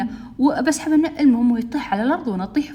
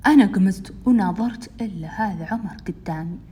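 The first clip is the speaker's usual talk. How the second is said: ordinary delivery, nothing marked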